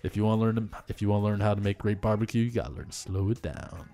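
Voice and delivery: low, soft, lightly voiced Rodriguez; Robert Rodriguez impression. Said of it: "Robert Rodriguez".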